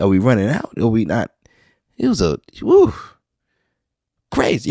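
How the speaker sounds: ordinary delivery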